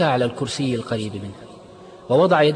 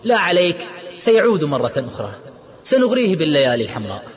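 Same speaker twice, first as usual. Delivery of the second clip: loud, higher, scornful outburst; angry shout; 5 semitones higher, 4 dB louder than usual. Acted. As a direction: shout it down in anger. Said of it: "صرخ عماد غاضبا".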